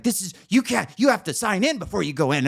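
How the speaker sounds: mimicked agitated objection; freaking out. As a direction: stumble over the order in mock panic. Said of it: "she's freaking out".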